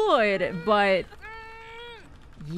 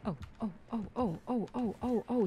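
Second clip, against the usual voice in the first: hushed, tense little ohs; tense whisper; 9 dB quieter than usual. Real, not acted. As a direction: breathe it out quietly in panic. Killer right next to her.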